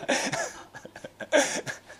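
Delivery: amused laughter, high and airy; hearty laugh. Real, not acted. Pastor joking about the congregation fleeing, amused laugh. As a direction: laugh at your own joke.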